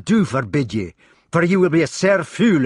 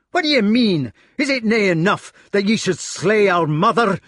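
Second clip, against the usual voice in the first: Scots tirade building louder and higher; roaring rage; 4 semitones higher, 4 dB louder than usual. A narrator's reading. Gawain advances on his sword-drawing brother, roaring. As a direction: rage at him in broad Scottish, building. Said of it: "roared Gawain".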